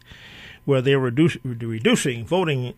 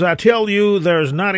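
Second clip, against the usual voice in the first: quoted caller, louder and higher at the start then settling; caller's emphatic quote; 8 semitones higher, 8 dB louder, slower than usual. Retold or imitated. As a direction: retell the caller's point with a punchy opening. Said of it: "One gentleman says".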